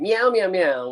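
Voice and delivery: imitated cat meowing, slightly high; cat meows. Reading a chatter's message as cat meows. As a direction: meow it out like a cat. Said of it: "we have Darth Kato going".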